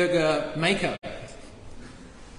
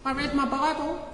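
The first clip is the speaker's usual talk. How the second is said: put-on higher, softer little kid excuse; whiny child voice; 7 semitones higher, 4 dB quieter than usual. Preacher mimics a child excusing themselves to God, higher and softer. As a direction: plead like a small child hiding behind parents.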